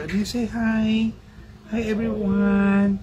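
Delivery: high, slow coaxing sing-song. Real, not acted. Mother urging her toddler to say hi, high slow coax.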